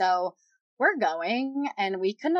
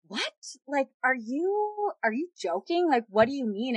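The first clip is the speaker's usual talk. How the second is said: retold incredulity; 5 semitones higher than usual. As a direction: question it as if refusing to believe. Retelling disbelief at shocking news.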